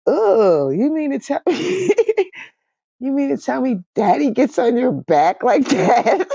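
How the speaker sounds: high, astonished and laughing question; incredulous child. Retold or imitated. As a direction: act out a little girl's shock. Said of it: "She said".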